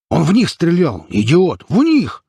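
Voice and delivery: high, exasperated shouting; worked-up high outburst; angry yelling. A narrator's reading. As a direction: hammer it at an idiot, pitch leaping up. Bull yelling at slon in quarrel.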